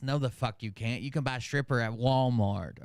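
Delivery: ordinary talk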